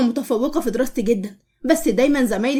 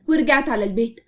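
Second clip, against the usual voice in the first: plain reading voice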